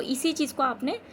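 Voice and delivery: ordinary delivery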